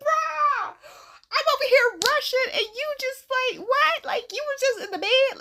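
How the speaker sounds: high-pitched, exasperated retelling; exasperated disbelief. Retold or imitated. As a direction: squeal it in mock outrage at your friend. Acted exasperated reaction to late friend.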